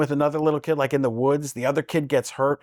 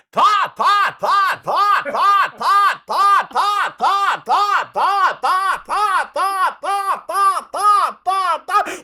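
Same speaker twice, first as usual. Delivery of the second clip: much higher, loud shouted ta-ta bursts; imitated trumpet; 7 dB louder, much slower than usual. Shown as a demonstration. Imitating [name] on trumpet, loud. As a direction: blast it like a trumpet. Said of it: "Like [name] playing the trumpet".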